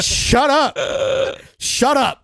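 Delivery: high-pitched playful protest; mock indignant retort. Retold comeback to younger manager's teasing.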